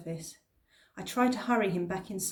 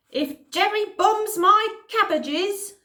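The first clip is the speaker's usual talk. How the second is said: put-on indignant old man; 12 semitones higher, 9 dB louder, slower than usual. Man voiced, indignant villager.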